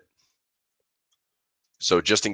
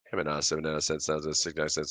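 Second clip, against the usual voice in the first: playful auctioneer calling out prices; 2 semitones lower, 6 dB quieter, faster than usual. Pretend. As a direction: call the bids like a jokey auctioneer. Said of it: "my auctioneer voice".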